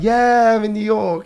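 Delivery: thrilled at arriving; excited. Acted. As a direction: hit the yeah loud and high, celebrating arrival. Acts out arriving in New York.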